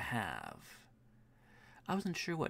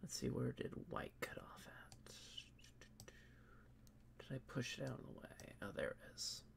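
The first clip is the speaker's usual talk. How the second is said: whispering to himself; 14 dB quieter, faster than usual. Real, not acted. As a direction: murmur under your breath as you work. Mutters to himself while editing.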